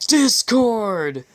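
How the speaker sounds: half-hearted growled name, not a real growl; playful mock-angry call. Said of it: "I growled".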